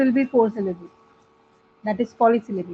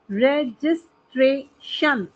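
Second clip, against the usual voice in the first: Registration slowly as रे-जिस-ट्रे-शन; syllable by syllable; much slower than usual. Shown as a demonstration. Teacher listing four-syllable words, syllable by syllable. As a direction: break registration into four beats. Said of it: "four syllables वाले word".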